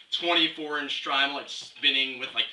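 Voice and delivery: normal talking voice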